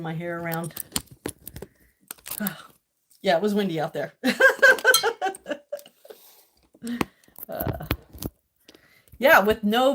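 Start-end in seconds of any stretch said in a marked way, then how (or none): 4.2-5.8 high laugh. Real, not acted. Laughing at the wind.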